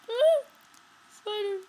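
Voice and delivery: high, very loud shriek; scream. Real, not acted. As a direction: scream at the spider. Scream at spider.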